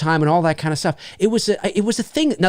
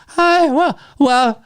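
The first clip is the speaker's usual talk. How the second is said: put-on much higher, louder fumbling kid voice; nervous kid announcer; 12 semitones higher, 3 dB louder, slower than usual. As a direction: play a jittery teen fumbling the mic. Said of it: "little Bobby who would come in and go".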